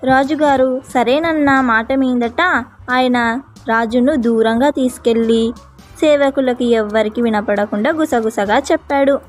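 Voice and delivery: ordinary delivery, nothing marked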